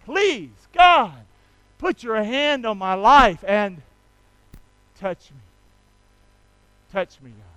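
leading the church in prayer. Shown as a demonstration. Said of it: "Say the prayer part with me".

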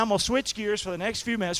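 usual speaking voice

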